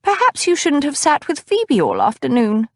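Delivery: put-on high, fussy older lady voice; fussy older cousin. A narrator's reading. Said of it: "Cousin Batilda observed".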